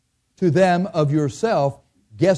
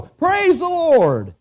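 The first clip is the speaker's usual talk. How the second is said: high, slow, drawn-out praise; joyful praise; 13 semitones higher, much slower than usual. Retold or imitated. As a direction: lift it up like a glad phone call. Caller praising God on phone.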